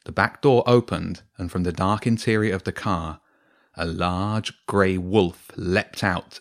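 usual speaking voice

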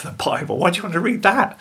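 high, incredulous scoff; laughing disbelief. Retold or imitated. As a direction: imitate a mocking sceptic, pitch leaping up. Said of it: "The man laughs".

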